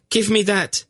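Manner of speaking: high-pitched furious demand; high, frantic outburst. A narrator's reading. Frantic struggle over a dagger.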